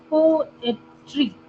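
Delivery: Poetry in three separate pieces; syllable by syllable. Shown as a demonstration. Teacher giving students an example, poetry split by syllable. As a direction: break poetry twice, one syllable at a time. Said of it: "दो बार break करना हो रहा है".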